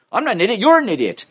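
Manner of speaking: high, heated comeback; acted indignant denial; angry retort. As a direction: throw the insult right back. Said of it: "angry content".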